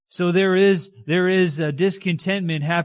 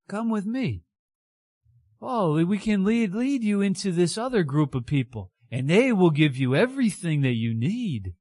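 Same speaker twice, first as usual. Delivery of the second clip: lightly voiced, higher, coaxing little demon; tempting demon; 6 semitones higher than usual. A demon luring Judas with silver, higher and coaxing. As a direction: sweet-talk him toward betrayal. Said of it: "a little demon who's sniffing around".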